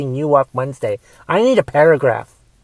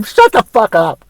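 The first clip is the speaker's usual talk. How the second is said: loud, fed-up shut-up; angry retort; 4 semitones higher, 4 dB louder than usual. Real, not acted. Host snapping back at Trump's bragging, loud and fed up. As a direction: cut him off in disgust.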